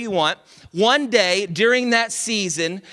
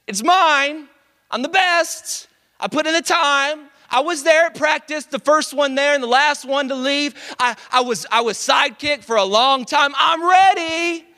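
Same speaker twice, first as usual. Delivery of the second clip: prideful boasting; 6 semitones higher than usual. Pretend. Voicing the prideful inner voice.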